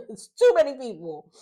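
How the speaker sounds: high, loud fed-up repeat of "too many"; exasperated outburst. Real, not acted. Ranting about too many contestants.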